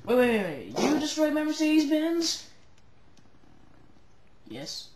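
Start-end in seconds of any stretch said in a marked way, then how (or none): 0.1-2.5 high, loud disbelief; shocked outrage. Acted. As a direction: react in shock to the wrecked car. Owner discovers destroyed car.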